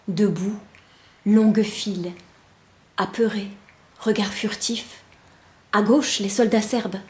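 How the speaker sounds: measured poetry reading, short phrases set apart by pauses; poem recitation. Acted. Reciting a poem of soldiers marching ever onward, solemn measured recitation. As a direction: recite word by word, steady as a march.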